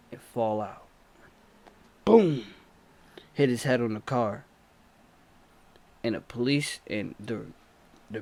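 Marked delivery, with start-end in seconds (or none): 2.0-2.4 loud, punchy spoken boom; boom sound effect. Retold or imitated. Narrator acting out the man hitting the car, loud boom. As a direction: slam the word like a crash. Man falls, hits head.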